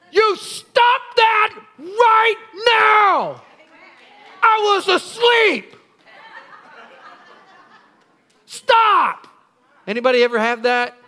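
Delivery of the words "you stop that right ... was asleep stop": cranky scolding. Acted. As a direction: yell at it like a grumpy woken sleeper. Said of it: "he didn't say".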